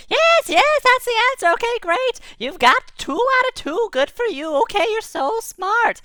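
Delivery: very high, excited tiny creature voice; a giddy little spark cheering; squeaky light voice. Acted. Ball of light congratulating the boy, very high and giddy.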